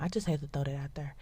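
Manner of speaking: fast whisper; whispered aside. Real, not acted. Host to listeners, quick whispered aside. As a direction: toss it off under your breath.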